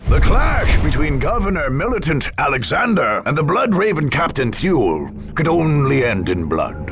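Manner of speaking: usual speaking voice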